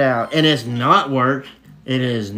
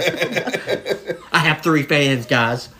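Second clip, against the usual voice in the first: slow words through laughter; laughing; much slower than usual. Real, not acted. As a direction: laugh it out, drawn way out. Laughing at own joke.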